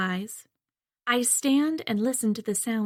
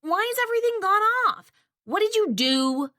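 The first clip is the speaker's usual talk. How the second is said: put-on high, fast, louder boy's yell; angry teen brother shouting; whiny teen shout; 12 semitones higher, 4 dB louder than usual. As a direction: demand answers like an outraged teenage brother. Teen brother shouting, power gone.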